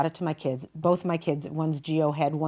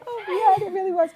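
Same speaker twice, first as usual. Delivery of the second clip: laughing; 14 semitones higher than usual. Real, not acted. Earring nearly fell off.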